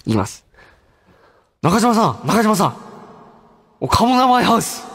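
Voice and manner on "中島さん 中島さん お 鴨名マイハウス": higher-pitched, beaming actor impression; Masato Sakai. Host doing a requested actor impression with a broad smile, high and cheery. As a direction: call out high with an unclouded grin. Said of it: "この堺さんのモノマネしてるんですけど".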